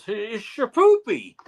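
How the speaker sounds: high, slow dopey hero voice; mock dim-witted strongman; goofy Ram-Man. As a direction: play it like a lovable lunk. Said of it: "and Ram-Man goes".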